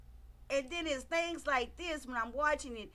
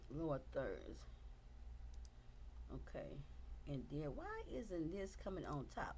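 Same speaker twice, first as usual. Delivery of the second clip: muttering aside; 10 semitones lower, 14 dB quieter than usual. Real, not acted. Fixing stream overlay, talking aside.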